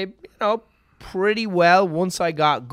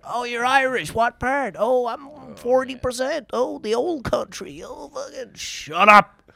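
higher, gushing tourist voice then a snapped ending; mock bar customer; 8 semitones higher than usual. Bartender mimicking customers who fawn over his Irish accent, higher and gushing. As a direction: ham up an eager American fan of Ireland. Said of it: "ten years just of the same conversations".

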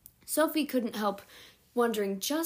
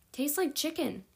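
higher, lightly voiced teen girl; girl's voice; 6 semitones higher than usual. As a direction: say it like a casual teenage girl. Sophie's voice, higher timbre.

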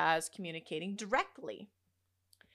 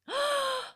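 gasp. Retold or imitated. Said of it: "I was like".

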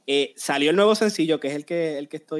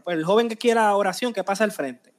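raised, louder preacher calling out; imitating a preacher's altar call; 5 semitones higher, 4 dB louder than usual. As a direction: call the young ones forward from the pulpit. Preacher calling youth forward.

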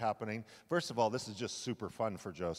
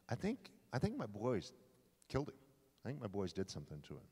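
softer, muttered fear about his sons; quiet suspicion; 3 semitones lower, 6 dB quieter than usual. Acted. Jacob suspecting his sons killed Joseph, quiet brooding. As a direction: voice the dark thought under your breath.

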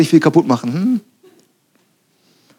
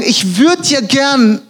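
gehemmtes Wollen; 6 semitones higher, much slower than usual. Retold or imitated. Acting out people held back from speaking.

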